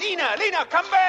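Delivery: high, loud desperate calling. Acted. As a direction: call her back in alarm. Husband calls after wife.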